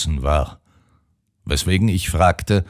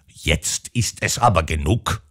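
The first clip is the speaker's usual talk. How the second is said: ordinary delivery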